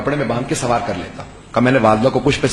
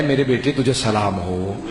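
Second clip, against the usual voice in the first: low, soft, slow blessing to the son; holy blessing; 4 semitones lower, 4 dB quieter, slower than usual. Acted. Imam greets the devoted son. (امام کا بیٹے کو سلام، گہری نرم آہستہ آواز)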